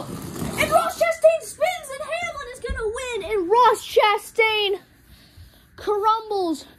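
high, drawn-out crash commentary; excited race call. Real, not acted. Commentator as a driver crumbles at the finish, excited. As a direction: cry out the spin, pitch jumping up.

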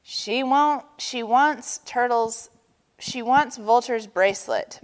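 ordinary delivery, nothing marked

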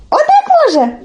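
very high little questioning voice; naive Telesyk. Acted. Telesyk checks with Olenka, high and naive. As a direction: ask it innocently, way up high. Telesyk feigns not knowing how.

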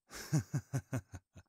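a sudden high, teasing laugh; high mocking laugh. Vampire teasing the walker in the woods, high mocking laugh. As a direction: laugh at her like she's prey.

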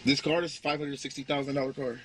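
ordinary talk

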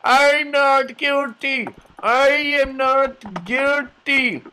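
very high, drawn-out mock wail; slow, loud, hammed-up prisoner's plea; protesting prisoner. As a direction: wail innocence slowly at full volume. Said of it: "They'll be like".

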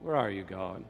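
low, subdued plea to God; forlorn question. Acted. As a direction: ask it as a lost, lonely soul. Human calling out to absent God.

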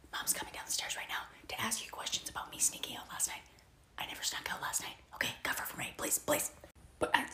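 urgent hushed whisper. Acted. Sister begging brother to cover, urgent whisper. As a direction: whisper fast so mom won't hear. Sibling sneaking, hiding from mom.

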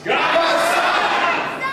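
high, loud call. Acted. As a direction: call it out to the sky. Audience call response.

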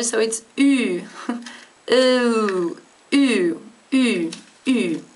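ü said as a rounded ee, short; ü, ü, ü repeated, lips rounded; ü demonstrated for learners; Swiss German ü. Shown as a demonstration. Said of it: "say it really quickly".

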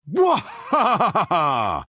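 drawn-out "wha-ha-ha" holler; long wavering yell. Acted. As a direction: holler through a wild ride. Wordless cry in flight.

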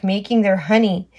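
plain reading voice

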